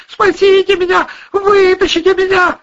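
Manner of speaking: very high, loud cry for help; desperate wail. A narrator's reading. Girl in the pit begging for rescue, wailing. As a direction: scream to be rescued. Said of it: "завопила Жанна из подземелья".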